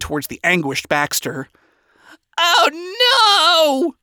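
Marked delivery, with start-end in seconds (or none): 2.4-3.9 high, wailing cry of dread; panicked yell. A narrator's reading. Scientist as knife-wielding Nifty approaches, panicked yell. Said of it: "scientist yelled in panic".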